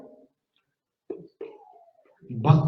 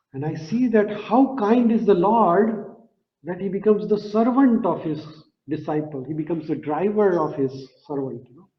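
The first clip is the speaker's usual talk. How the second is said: normal talking voice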